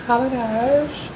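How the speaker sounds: high-pitched fish voice; gentle-worded high storybook fish; magic fish. A narrator's reading. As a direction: send him home in a high creature voice. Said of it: "de vis antwoordde".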